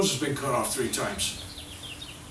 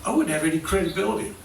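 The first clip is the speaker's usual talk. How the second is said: ordinary talk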